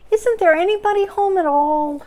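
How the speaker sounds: high call into a hole. Acted. Pooh calling into Rabbit's hole, high call. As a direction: call down the burrow, hopeful.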